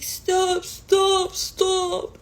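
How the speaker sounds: high urgent stop stop stop; friends calling stop. Retold or imitated. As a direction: pull her back with each stop. Friends holding her back.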